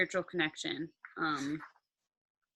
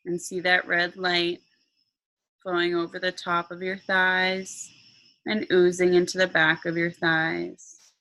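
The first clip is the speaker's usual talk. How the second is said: slow meditation voice with long pauses; guided meditation; 5 dB louder than usual. Acted. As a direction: guide them calmly into relaxation.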